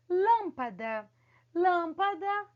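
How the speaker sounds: "Lâmpada" slowly, LÂM stretched and strong; stressed first syllable. Shown as a demonstration. Teacher showing the circumflex gives stronger intonation, stressed. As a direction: hold and punch the LÂM of "Lâmpada". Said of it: "uma entonação mais forte pra essa sílaba".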